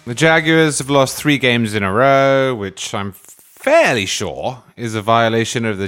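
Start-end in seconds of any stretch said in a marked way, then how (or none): none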